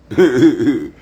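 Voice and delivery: loud rapid-fire chuckle; chuckling. Real, not acted. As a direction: burst out in a quick, loud chuckle. Laughs after his own remark.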